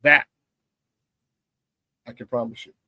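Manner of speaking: normal talking voice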